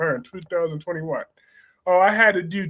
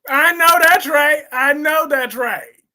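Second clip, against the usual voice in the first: loud agreement; 7 semitones higher, 7 dB louder than usual. Real, not acted.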